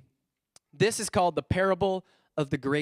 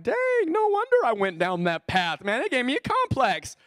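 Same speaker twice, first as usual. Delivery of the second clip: mock outrage; 7 semitones higher, 3 dB louder than usual. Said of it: "I'm like".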